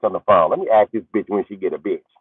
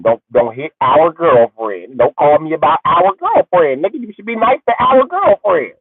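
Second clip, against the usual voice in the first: mock stern warning; 8 semitones higher, 3 dB louder, slower than usual. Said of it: "I told him".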